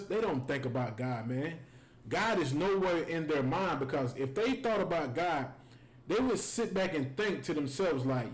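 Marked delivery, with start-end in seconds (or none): none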